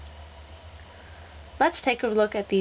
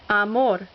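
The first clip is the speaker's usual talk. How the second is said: amor with MOR stressed; stress on last syllable. Shown as a demonstration. Teacher showing examples of rule two, stress on last syllable. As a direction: land on the final syllable of amor. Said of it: "accent on the last syllable".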